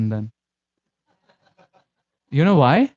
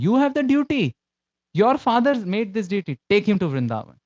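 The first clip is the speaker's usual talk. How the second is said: much higher, firm insistence on duty; commanding official; 6 semitones higher than usual. Retold or imitated. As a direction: lay down the duty to the son. Official orders Krishna Chaitanya Goswami.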